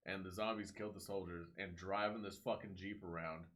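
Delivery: ordinary delivery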